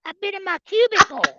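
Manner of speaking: high, loud put-on whine; mock coworker voice. Man imitating coworker's excuse for missing out, high put-on voice. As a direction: answer in a hammed-up high voice. Acts out coworker's sheepish answer.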